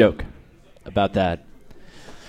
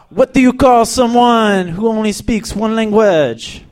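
higher-pitched put-on European accent; mock European accent; 5 semitones higher than usual. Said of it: "they have a little joke".

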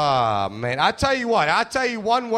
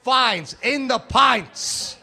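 stern cop; 6 semitones higher, much slower than usual. Acted. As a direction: bark it slowly like a dumb cop. Cop retorts.

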